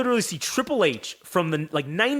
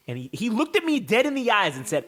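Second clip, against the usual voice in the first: ordinary talk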